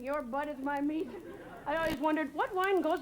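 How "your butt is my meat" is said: slow, lightly put-on mom voice, stressing meat; a hint of an angry mom, leaning on meat; mom's phrase, slowed and pointed; mom's threat repeated. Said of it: "She would in front of people".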